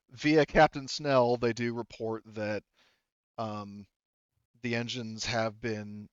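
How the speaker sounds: ordinary delivery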